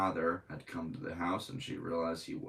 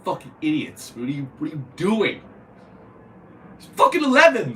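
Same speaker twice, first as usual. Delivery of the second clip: real angry yelling, much higher and louder; angry outburst; 10 semitones higher, 13 dB louder than usual. Real, not acted. Streamer outraged at cops shooting kids, angry yelling. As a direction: yell in outrage at the police. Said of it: "I startled my dog".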